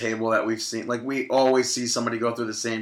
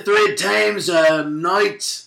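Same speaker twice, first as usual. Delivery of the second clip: higher, drawn-out mock Scottish accent; Drew McIntyre; 5 semitones higher, slower than usual. Co-host mimics a wrestler mid-discussion, exaggerated Scottish accent. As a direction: put on a hammed-up Scottish brogue. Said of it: "That's my Drew McIntyre impression".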